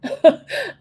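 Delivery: a high, loud burst of laughter; sudden high laugh. Real, not acted. Breaking down on stream, then a loud high laugh.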